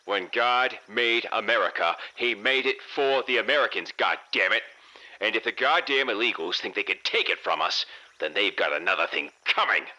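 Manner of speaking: put-on nasal, high-pitched old crank; outraged old man. A narrator's reading. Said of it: "a nasally sounding old man came through on the air, his high-pitched voice tremoring with outrage".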